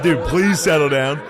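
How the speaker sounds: loud calming plea. Retold or imitated. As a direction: raise your voice to settle the crowd down. Calming the rowdy crowd.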